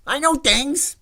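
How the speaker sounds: high, loud mock outburst; hammed-up high-pitched insisting; Fredo impression. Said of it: "right after he said".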